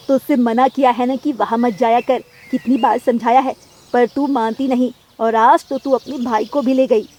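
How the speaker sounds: loud scolding mother; angry scolding. Acted. Mother scolds while beating child. (ऊँची आवाज़ में माँ की डाँट)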